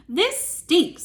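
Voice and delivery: high, loud mock disgust; hammed-up princess disgust; disgusted complaint. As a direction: whine about the smell. Princess complains inside belly.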